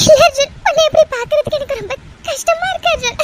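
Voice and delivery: distraught sobbing; crying. Acted. Woman blaming herself at his bedside, sobbing. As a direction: break into guilty sobs. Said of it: "எதுக்குடி அழுதுட்டு இருக்க".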